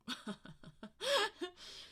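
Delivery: short burst of amused laughter; real, high-pitched little chuckle. Real, not acted. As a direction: giggle at your own silly example. Laughs at own low-angle demonstration.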